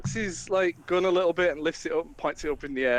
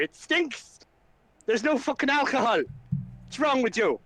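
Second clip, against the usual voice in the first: high, scornful disbelief; ranting at the messenger about Barovia; incredulous rant; 8 semitones higher than usual. Acted. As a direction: sneer at the very idea. Flynn rants about Barovia.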